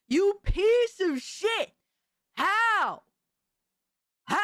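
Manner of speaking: slow, high-pitched indignant shout; hammed-up disbelief; mock outrage. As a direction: play scandalized, stretching the words. Mock outrage at spaghetti confession.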